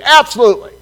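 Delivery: high, loud, eager yes; a loud, delighted absolutely; eager shout. Retold or imitated. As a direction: jump at the chance. Eager reply to John.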